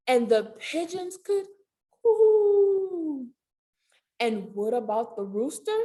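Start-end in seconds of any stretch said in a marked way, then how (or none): 2.0-3.3 higher cooing; pigeon coo. Pretend. Pigeon sound imitation.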